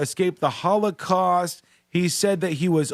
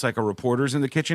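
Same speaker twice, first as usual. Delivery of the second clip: ordinary talk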